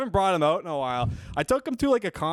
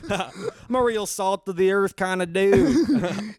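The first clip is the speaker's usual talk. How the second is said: slow, put-on Southern country boy; Southern accent; 3 semitones higher, slower than usual. Comic riffing on farming family roots, slow Southern accent. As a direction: play the plain Southern farm fella. Both play Southern farm folk.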